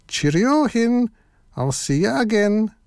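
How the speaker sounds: high, lightly voiced wolf goodbye; wolf's farewell. A narrator's reading. Wolf takes his leave.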